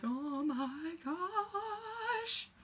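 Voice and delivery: high, very slow awed sigh; drawn-out awe. Retold or imitated. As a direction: stretch it out in awe. Everyone in awe together.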